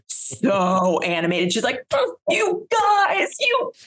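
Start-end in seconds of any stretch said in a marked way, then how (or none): 1.9-3.7 high, slow, teary gushing; mock crying. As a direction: sob it out like an overcome star. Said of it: "And she's crying".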